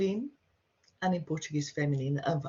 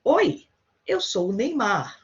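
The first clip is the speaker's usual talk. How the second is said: Oi jumps up high and loud, then eu sou o Neymar; a cheery high Oi to show the casual greeting; bright mock greeting; 5 semitones higher, 6 dB louder than usual. Shown as a demonstration. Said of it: "you've seen two greetings here".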